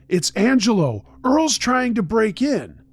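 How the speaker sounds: high, loud cry for help; yelled out. A narrator's reading. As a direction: yell urgently down the phone. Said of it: "I just yelled out".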